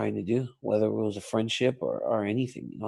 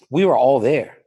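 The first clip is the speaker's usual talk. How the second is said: emphatic louder; 2 semitones higher, 8 dB louder than usual. Real, not acted. Pressing his point to host.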